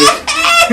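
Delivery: very high, loud squealed laugh; hammed-up helpless laughter; mock laughing fit. Showing how truly amused people laugh, exaggerated squealing laugh. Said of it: "そんな笑い方するやつ".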